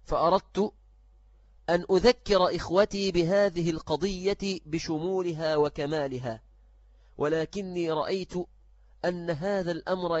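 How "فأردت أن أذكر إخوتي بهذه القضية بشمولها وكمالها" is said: ordinary talk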